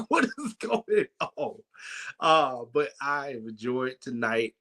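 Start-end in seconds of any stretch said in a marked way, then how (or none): 0.1-1.6 high, slow alarmed voice; mock alarm. Preacher reacting to Elder Hope's wig, high slow alarm. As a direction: drag it out high in disbelief.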